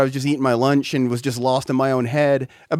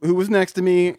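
normal talking voice

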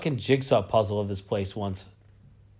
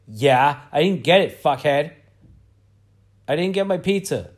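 louder, angry comeback at the counter; retold angry snap; 3 semitones higher, 7 dB louder than usual. To the rude staff, a loud retold angry comeback. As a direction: fire back like you've been cheated. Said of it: "And I was like".

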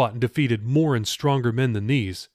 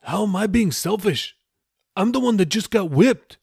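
high, offended protest; Raoul, indignant; 9 semitones higher than usual. Acted. Raoul defends himself against Jeffy, indignant and high. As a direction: push back at the accusation.

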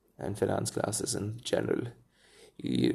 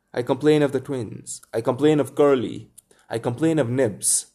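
louder, higher complaining cries; tattling; 3 semitones higher, 5 dB louder than usual. Acted. A lost boy tattling on the others at supper, loud complaints. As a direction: tell on everyone in a rush. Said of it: "The hateful telling broke out again".

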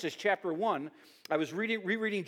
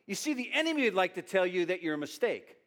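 ordinary talk, nothing marked

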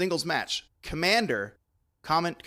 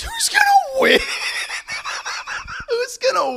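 high cackling witch; 11 dB louder than usual. Acted. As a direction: screech it like a witch. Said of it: "You sounded like a witch".

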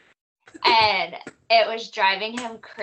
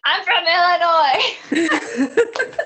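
louder, higher, laughing insistence; indignant protest; 9 semitones higher, 9 dB louder than usual. Retold or imitated. Insisting to her exes that she is from Illinois, laughing indignation. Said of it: "And I'm like".

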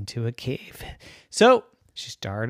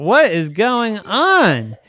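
high, loud, slow alarmed cry; freaking out; 8 semitones higher, 7 dB louder, slower than usual. Acted. Girl scared by singing cave, alarmed cry. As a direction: panic rising in the voice.

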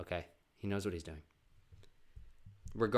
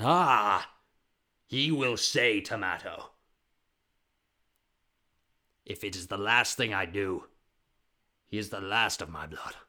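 loud, hammed-up solemn vow; melodramatic father; 9 dB louder, slower than usual. Father vowing his son will say tomato, melodramatic. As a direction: declaim it like a dramatic patriarch.

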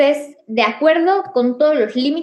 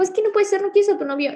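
gente escandalizada; 7 semitones higher, faster than usual. Retold or imitated. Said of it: "la gente se choquea así como".

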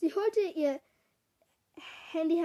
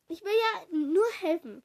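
anfahren; 4 semitones higher, 4 dB louder than usual. A narrator's reading. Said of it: "fuhr Kara mich an".

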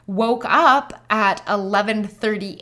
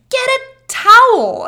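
high, loud and slow, insistent; urgent command; 10 dB louder, slower than usual. Retold or imitated. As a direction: bark the order at him in a rush. Urgent demand to husband.

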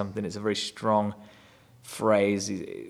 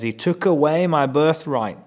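higher and louder, aggrieved protest; indignant cry; 6 semitones higher, 6 dB louder than usual. Retold or imitated. Esau crying out against his brother, indignant and hurt. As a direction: throw out the accusation. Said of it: "which is not particularly true".

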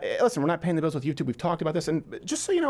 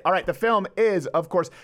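ordinary talk

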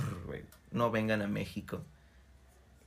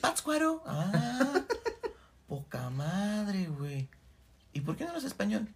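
mock high excited voice; 10 semitones higher, 3 dB louder, slower than usual.

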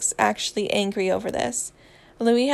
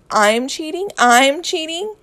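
high, loud questions building in disbelief; incredulous; 7 semitones higher, 7 dB louder than usual. A narrator's reading. Harry, accused by Louis, loudly incredulous. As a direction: throw the accusation back, higher the second time.

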